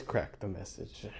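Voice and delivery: breathless mock whisper. Parody coach whispering his lesson. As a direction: hiss it like a secret. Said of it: "whispered line".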